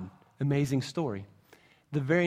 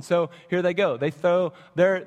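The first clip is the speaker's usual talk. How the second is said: normal talking voice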